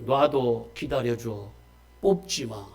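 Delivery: a patient master asking to wait for it; gentle patient plea. Acted. Jesus gently asks to wait. (부드럽고 아주 느리게 달래듯이)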